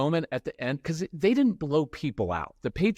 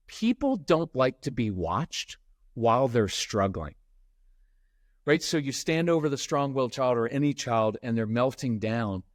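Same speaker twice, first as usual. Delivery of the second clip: ordinary delivery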